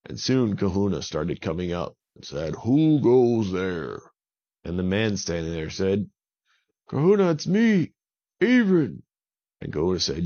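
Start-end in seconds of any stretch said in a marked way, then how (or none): 2.6-4.0 very slow, louder booming spirit; Kahuna's challenge. A narrator's reading. Kahuna rises from the lava to challenge, slow and booming. 6.9-9.0 much higher, loud pleading call. A narrator's reading. Avon calls out to Kahuna at the volcano, high and loud.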